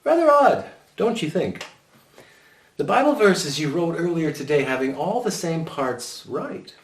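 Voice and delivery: high, pointed questioning; suspicious teacher. A narrator's reading. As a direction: probe the boys knowingly. Mrs Jordan questions the schoolwork.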